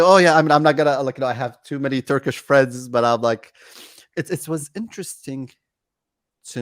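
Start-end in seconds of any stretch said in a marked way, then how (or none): 0.0-2.9 loud, high, insisting I have Turkish friends; retold loud protest to friends; emphatic protest. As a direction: protest it loudly, half joking. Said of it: "I say".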